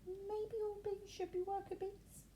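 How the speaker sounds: high, soft, small bee voice; small puzzled voice. A narrator's reading. Little bee asking the crowd, small and puzzled. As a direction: ask it tiny and unsure. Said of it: "in a small puzzled voice".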